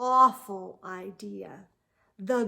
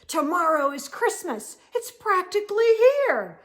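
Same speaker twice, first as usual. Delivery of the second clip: rising, louder Grinch complaint; whiny Grinch; 7 semitones higher, 6 dB louder than usual. Acted. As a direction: whine it higher and higher, fed up. Grinch complains about Christmas.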